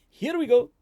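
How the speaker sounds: high, loud hype call; excited announcer. Acted. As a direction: build it up like a host. Jingle lead-in.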